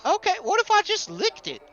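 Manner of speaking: put-on high, childlike, hopeful ask; hopeful Gus. Pleading with a reluctant stranger, hopeful and high. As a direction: bargain eagerly in a high voice.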